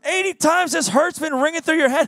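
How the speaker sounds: high, indignant disbelief; can't believe they took it; outraged disbelief. Retold or imitated. David to the soldiers, high and indignant.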